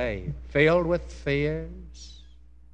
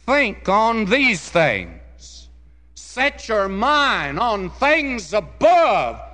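impassioned scripture preaching; 6 semitones higher, 7 dB louder than usual. Acted. Preacher urging think on these things, impassioned scripture climax. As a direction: ring out each phrase for the congregation.

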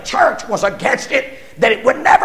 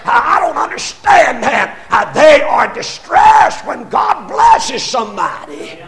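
loud, higher preaching; full-force preaching; 4 semitones higher, 5 dB louder than usual. Real, not acted. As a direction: drive it home from the pulpit.

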